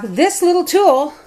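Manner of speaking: this little tool lifted high and drawn out; high, slow. Real, not acted. Showing viewers her slicer, high and slow with delight. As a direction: sing out this little tool slowly, proudly.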